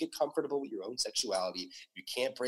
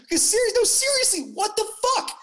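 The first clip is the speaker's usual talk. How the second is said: very high, loud disbelieving cry; exasperated outburst; 8 dB louder than usual. Real, not acted. Co-host demanding to know why homophobes think so, exasperated outburst. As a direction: burst out, voice shooting way up.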